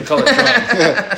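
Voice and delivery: high, laughing disbelief. Real, not acted. Friend laughing at the movie calling it just drugs. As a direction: laugh through it, amused.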